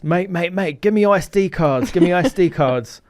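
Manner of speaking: high, loud London geezer accent, imitated. Young man demanding SD cards from the filmer, loud London accent. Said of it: "in a very English, London-y accent".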